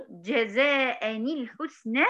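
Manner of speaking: جزاء الحسنى slow, joined as "jazā'ani-l-ḥusnā"; tajweed demonstration. Shown as a demonstration. Teacher shows how she says it, slow linked recitation. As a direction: draw out جزاء الحسنى, sounding the linking ni. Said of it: "لما أقول".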